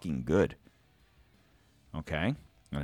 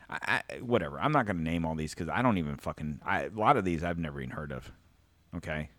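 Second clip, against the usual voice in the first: ordinary delivery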